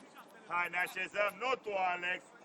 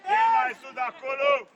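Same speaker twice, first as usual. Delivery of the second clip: loud, higher-pitched touchline shout; urgent shout; 4 semitones higher, 8 dB louder than usual. Real, not acted. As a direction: shout the order across the pitch. Coach calling out to players. (strigăt de antrenor, tare și mai înalt)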